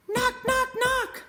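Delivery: high, bright knock sounds; knocking sound word. Acted. Mole knocking on Bear's door, high and bright. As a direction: rap out each knock.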